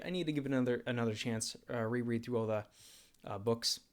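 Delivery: ordinary talk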